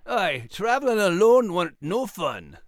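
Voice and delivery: a proud little Scottish terrier; gruff Scottish brogue, defensive; Scottish dog Max. Acted. Max the dog protests his bravery in a Scottish accent. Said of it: "Max Scottish dog character".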